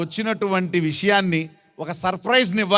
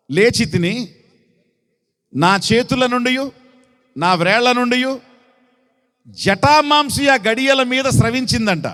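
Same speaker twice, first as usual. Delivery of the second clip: raised, declaiming Bible reading voice; scripture reading; 4 semitones higher, 3 dB louder than usual. Acted. Preacher reads the bride's lament to the church, declaiming. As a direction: proclaim the verse to the congregation.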